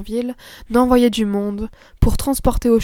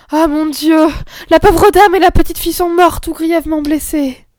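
higher and louder, in horrified alarm; horrified cry; 6 semitones higher, 6 dB louder than usual. Acted. Nurse finding mother and child bloodied, horrified cry.

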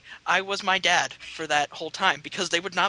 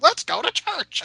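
high, bright-pitched invitation; imitating grandma. Grandma inviting him to church, high mock voice. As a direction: chirp it like an eager grandma.

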